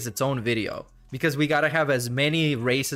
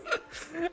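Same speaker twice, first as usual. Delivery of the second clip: high, soft real laugh; 14 semitones higher, 10 dB quieter than usual. Real, not acted. Streamer cracking up at silly clips, high soft laugh.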